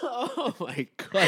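high, slow laughing disbelief. Real, not acted. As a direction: gasp it out through laughter.

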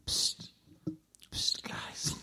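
unvoiced ghostly whisper. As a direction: hiss it softly to wake them. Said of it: "I'm whispering there".

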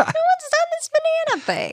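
very high silly squeak; squeaky high voice. Pretend. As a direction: pitch the voice way up as a joke. High squeaky banana bit.

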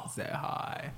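breathy whispered stoner. Retold or imitated. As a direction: whisper it like you're baked. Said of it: "I'm really high right now".

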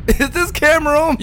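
loud, high-pitched mock interview outburst; hammed-up high, loud question; Robert Kelly. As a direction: shoot the voice way up, imitating a worked-up interviewee.

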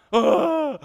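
a sudden high loud wail, half-mocking; retold sob cry. Pastor acting out his sobbing on the bridge deck, loud high wail. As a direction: burst out in a wailing cry. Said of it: "I'm just bawling".

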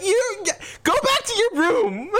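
high, drawn-out mock scolding; hammed-up parental telling-off. Acted parent sending child away.

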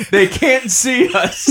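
laughing through the words, pitch up. Real, not acted. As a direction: giggle it out slowly. Laughing at own joke.

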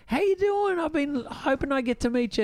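friendly Taylor Lautner. Retold or imitated. Actor chatting to his co-star's sister on FaceTime, eager high friendly. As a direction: charm the fan. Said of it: "and he's like".

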